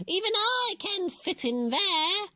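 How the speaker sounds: high, loud, slow crackling hag; cackling witch. A narrator's reading. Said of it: "crackled the witch".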